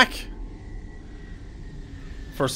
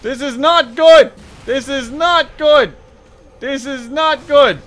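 raised pitch, slow insistent repeats; panicked alarm; 4 semitones higher, 4 dB louder than usual. Real, not acted. Gamer hunted in game, alarmed wailing. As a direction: wail it with growing dread.

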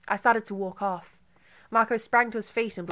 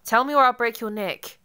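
louder, higher, every word evenly hammered; threatening shout; 4 semitones higher, 10 dB louder than usual. A narrator's reading. Marco threatens to break her neck, loud evenly stressed threat. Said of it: "giving each word equal emphasis".

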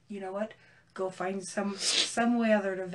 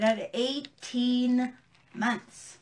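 slow emphatic; much slower than usual. Real, not acted. Stressing outrage at sentence.

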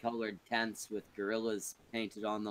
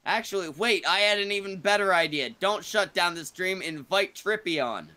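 loud, raised-pitch announcement; calling out orders; 6 semitones higher, 5 dB louder than usual. Real, not acted. Host tells Brockus to keep streaming, calling out loudly. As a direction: call out the sudden change of plan.

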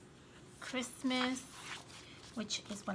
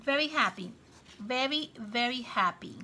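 usual speaking voice

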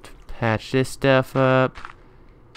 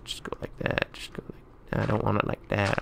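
whispering; 12 dB quieter than usual. Real, not acted. Whispering while building.

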